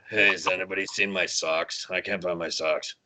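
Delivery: low, soft, groggy slacker voice; hungover college kid voice. Shown as a demonstration. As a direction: sound hungover and lost. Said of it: "it's my hungover college kid voice".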